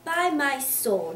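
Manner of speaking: slow, emphatic exclamation; slow hearty oath; Boythorn voice. Acted. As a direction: boom out the oath slowly. Boythorn's booming voice.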